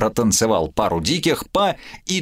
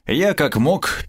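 ordinary delivery

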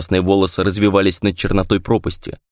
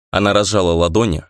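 plain reading voice

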